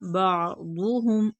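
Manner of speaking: बादुहुम slow, throat catch after बा and heavy दु; throat ain, full daad. Shown as a demonstration. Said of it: "दौत पुर पढ़ेंगे". (बादुहुम, हलक से आइन, पुर दौत)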